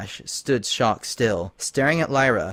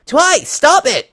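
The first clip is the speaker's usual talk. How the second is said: high, loud, slow shout; desperate cry; 10 dB louder, slower than usual. Acted. Dash shaking Twilight to release Lyra, desperate cry. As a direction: shake her and cry out. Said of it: "she cried".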